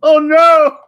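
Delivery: loud, high cry of dismay; hammed-up panic; mock horror. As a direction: cry out in fake alarm. Mimics horrified fans.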